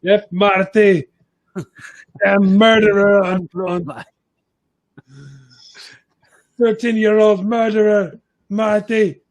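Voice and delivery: slow, high, loud mock ring announcer. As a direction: boom out the name like an introduction. Mocking announcer call of Marty.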